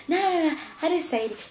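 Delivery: high, fast string of protests; retold frantic denial. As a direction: imitate him hurriedly denying everything. Accused man retold denying it again.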